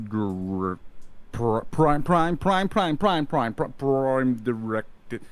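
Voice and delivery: stuttering, malfunctioning machine voice; robot voice skipping on prime; glitching robot voice. Acted. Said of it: "and you can hear it".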